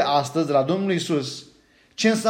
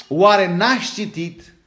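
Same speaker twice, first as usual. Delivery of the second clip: slow, weighty question; 3 semitones higher, 5 dB louder, much slower than usual. Acted. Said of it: "title question emphasized".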